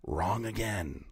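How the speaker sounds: soft, slow, smug "Wrong again."; quiet taunt. Real, not acted. Taunting the caller he just debunked, soft and slow.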